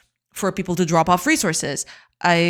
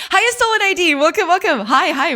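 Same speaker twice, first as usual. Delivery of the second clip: excited greeting; 10 semitones higher, 5 dB louder than usual. Real, not acted. Streamer greeting chat excitedly.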